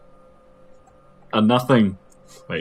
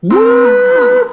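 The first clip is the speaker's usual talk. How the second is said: excited whoop; 13 dB louder than usual. Pretend. Hyped spoiler jingle.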